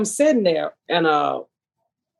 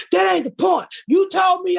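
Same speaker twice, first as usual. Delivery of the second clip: retold yelling; 11 semitones higher than usual. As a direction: shout it back like the angry ex, hammed up. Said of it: "If you are yelling".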